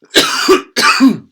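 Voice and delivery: put-on loud, high old woman's hacking cough; grandma coughing fit. A narrator's reading. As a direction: cough hard like an old hag. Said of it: "she started coughing".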